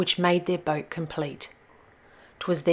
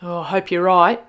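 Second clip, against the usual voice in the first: Skip's voice; 6 dB louder than usual. Acted. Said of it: "said Skip".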